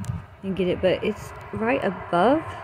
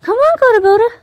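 very high, loud pet-talk voice; coaxing the dog; 13 semitones higher, 10 dB louder, faster than usual. Real, not acted. Owner begs dog Coda to come, very high coaxing call. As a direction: pitch it way up to coax a worried dog over.

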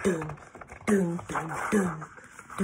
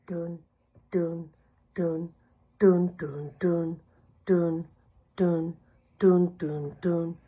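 hushed, steady "dun, dun"; suspense beats. Acted. Suspense beats before the reveal.

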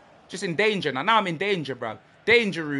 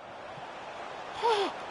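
sharp gasp; 10 semitones higher, 14 dB quieter than usual. Real, not acted. Sudden chance, sharp intake.

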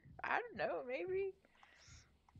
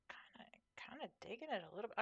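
soft fast whisper to herself; barely voiced aside; 16 dB quieter, faster than usual. Real, not acted. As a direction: murmur it under the breath, quickly. Whispering to self.

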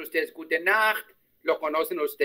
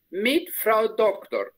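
"mit Frau Doktor" despacio, bajando al final; lento y cerrado; 2 semitones higher, much slower than usual. Shown as a demonstration. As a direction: cierra el modelo. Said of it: "repite la alumna". (despacio y bajando al final)

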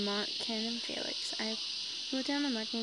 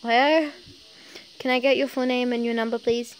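a little too fast; 2 semitones higher, 12 dB louder than usual. Acted. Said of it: "he said a little too fast".

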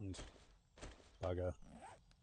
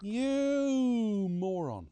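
drawn-out louder scolding; slow exasperated insult; 6 semitones higher, 5 dB louder, much slower than usual. Real, not acted. Player scolding teammate Steve, slow and exasperated. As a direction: drag out the insult in disbelief.

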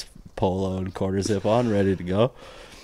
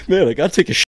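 put-on young guy's excuse; 10 semitones higher, 8 dB louder, much faster than usual.

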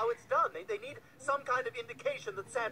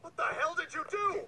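high-pitched, appalled demand; appalled outburst; 6 semitones higher than usual. Acted. Confronting a partner over the attack, appalled outburst. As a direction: demand an answer in alarm.

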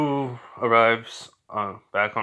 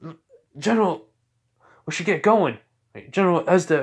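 grunt soldier; 6 semitones higher, 4 dB louder, faster than usual. Acted. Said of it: "a grunt comes and goes like".

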